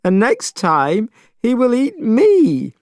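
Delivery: high, slow tearful wail; crying. A narrator's reading. Young priest crying to Monkey, high and tearful. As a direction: sob out the fear of being eaten. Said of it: "still crying".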